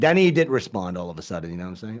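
ordinary talk, nothing marked

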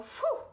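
high, loud sigh of relief; hammed-up phew for kids to copy; exhausted whew. Shown as a demonstration. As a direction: blow out a tired "whew". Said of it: "That must have been tiring".

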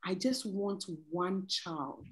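slow, soft, heartfelt doctor's words; quoted sincere confession. Retold or imitated. Doctor confiding to a pregnant patient, slow and soft. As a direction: let it drop low and slow, like a private admission.

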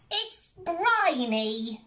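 high, slow squeal of disgust; disgusted pirate girl. Acted. Peg complains to the crew about the fish pie, high and slow.

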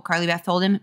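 ordinary delivery, nothing marked

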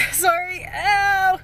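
loud high cry of pain; pained yelp. Real, not acted. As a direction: yelp as the tape pulls. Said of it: "That hurts".

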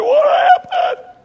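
very high, loud, drawn-out cry of alarm; hammed-up motherly panic; panicked mother. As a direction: shriek it like an alarmed mom. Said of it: "You know, the mother thing".

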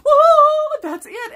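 a burst of high, loud delight; hammed-up eureka; eureka moment. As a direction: shout it as the idea lands. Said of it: "I was like".

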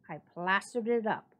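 ordinary delivery, nothing marked

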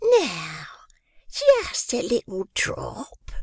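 high, slow, whispered persuasion; persuasively. A narrator's reading. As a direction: coax him in a hushed sing-song. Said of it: "said Missus Mann persuasively".

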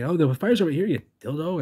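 4 lightly mocking shout at Jay; 1 teasing call-out. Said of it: "he's saying".

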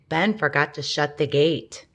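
usual speaking voice

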